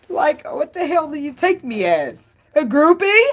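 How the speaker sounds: a woman insulted who won't be taken for a groupie; offended woman. Pretend. Woman snapping at a man who treats her like a groupie, indignant. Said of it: "That's me".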